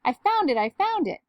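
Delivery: high, elated exclamations; excited shout. A narrator's reading. Doctor Dinglebat announcing his find, excited shout. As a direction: burst out with the good news. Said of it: "shouted Doctor Dinglebat".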